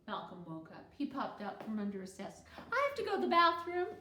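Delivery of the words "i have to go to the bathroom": put-on high, loud and fast little boy; urgent child. A narrator's reading. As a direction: blurt it out in a hurry. Malcolm wakes, urgent.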